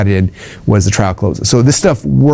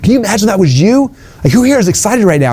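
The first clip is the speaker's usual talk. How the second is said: much higher, pumped-up speaker voice; hyped stage questions; 9 semitones higher than usual. Shown as a demonstration. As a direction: fire up the crowd from stage. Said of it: "I'll ask a question like".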